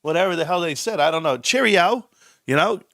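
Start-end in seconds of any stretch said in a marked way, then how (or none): 1.4-2.1 high, hammed-up British "Cheerio"; cartoon British accent, pitched up; mock British accent. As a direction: toss off a cheery British goodbye. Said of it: "I don't have all the languages down, all the accents".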